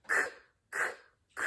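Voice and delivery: crisp "k" sound repeated; letter sound k. Shown as a demonstration. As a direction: say "k, k, k" as a short clipped sound. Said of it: "Say it".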